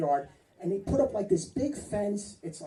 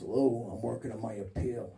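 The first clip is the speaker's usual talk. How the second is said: lower, muted voice of the inmate friend; a hint of a mumbling prisoner; low jailbird voice; 10 semitones lower, 6 dB quieter than usual. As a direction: lightly play the con insisting he's innocent. Jailed friend quoted during visit.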